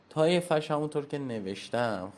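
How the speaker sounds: normal talking voice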